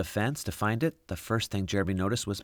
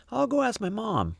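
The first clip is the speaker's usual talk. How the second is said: put-on much higher boy's voice; kid voice; 14 semitones higher than usual. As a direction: play the boy, voice up high. Jeremy voiced.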